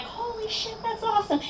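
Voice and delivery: high, hushed imitation of cheering onlookers; mock cheering. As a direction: act out an awed cheer at reduced volume. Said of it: "They were cheering".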